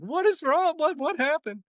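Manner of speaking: very high mock-weepy questions; high-pitched retold wail; imitated crying. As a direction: imitate an upset wife bursting into tears. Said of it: "she, like, starts crying".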